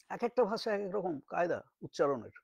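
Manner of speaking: ordinary delivery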